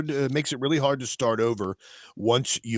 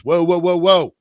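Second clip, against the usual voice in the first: louder, higher startled stop; startled whoa; 6 semitones higher, 9 dB louder than usual. Real, not acted. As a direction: slam the brakes in disbelief. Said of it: "I'm sorry, hold on".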